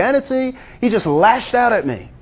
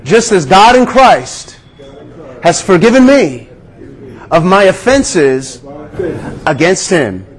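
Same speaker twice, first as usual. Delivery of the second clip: prayer recited aloud. Shown as a demonstration. Pastor leads the congregation in a vow, slow and emphatic. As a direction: lead the room in a spoken vow, word by word. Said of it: "Say it with me today".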